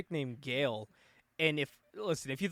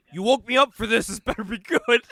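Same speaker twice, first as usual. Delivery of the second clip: loud, pitched-up mock boss voice; mock villain demand; 4 semitones higher, 10 dB louder than usual. Friend retelling a movie villain's line, loud mock demand. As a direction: bark it like an annoyed big shot.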